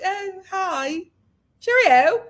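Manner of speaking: polite mock-British brush-off; underwhelmed British greeting. Host imitating Ryan unimpressed at meeting Cuba, polite brush-off. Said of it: "he was like".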